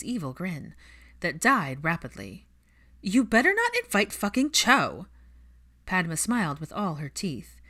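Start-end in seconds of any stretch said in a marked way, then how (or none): 3.0-5.1 high, loud horrified protest; Pansy recoiling at the idea, loud and high; alarmed outburst. Acted. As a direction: blurt it in outrage.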